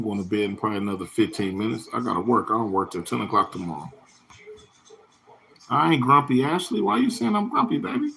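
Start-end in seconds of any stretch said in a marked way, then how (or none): 5.7-8.1 playful whine. Real, not acted. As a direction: coax her with a pitched-up whiny plea. Teasing viewer Ashley about grumpy.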